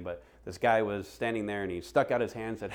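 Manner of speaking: ordinary talk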